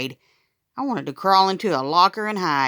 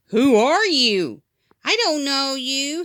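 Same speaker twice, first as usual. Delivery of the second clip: teasing little brother; 9 semitones higher, slower than usual. Acted.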